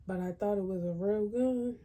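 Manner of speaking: ordinary delivery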